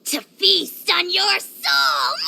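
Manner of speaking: slow hammed-up villain threat ending in a laugh; mock villain. Dragon teasing the traveller, hammed-up villain. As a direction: play the big bad menace for fun.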